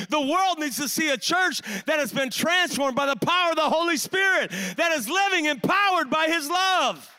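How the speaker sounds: full-force revival preaching; fiery preaching. Real, not acted. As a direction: preach it hard to stir the room.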